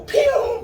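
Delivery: sharp high "pew" for a dog shooting off; zoom sound. Retold or imitated. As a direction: yelp it out like a dog bolting. Dogs bolting off into park.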